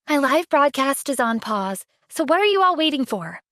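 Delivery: ordinary delivery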